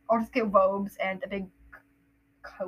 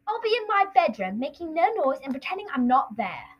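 put-on high, lilting boy's reply; not toneless; 4 semitones higher than usual. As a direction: recite it with too much tune. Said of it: "That wasn't very toneless".

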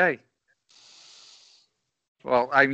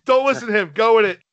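excited; 5 semitones higher, 8 dB louder, faster than usual. Real, not acted. Brushing off the interruption.

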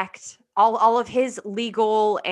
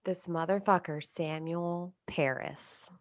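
whispering; 2 semitones lower, 9 dB quieter than usual. Real, not acted. Naming the culprit in hushed tone.

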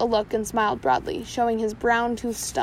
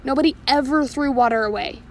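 plain reading voice

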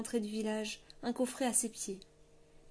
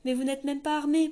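higher, louder protest; 5 semitones higher, 8 dB louder than usual. A narrator's reading. General protesting to the unarmed king, higher and louder. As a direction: push back in alarm. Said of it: "protesta-t-il".

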